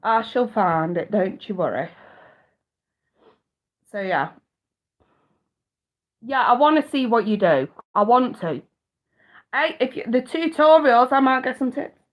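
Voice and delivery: ordinary talk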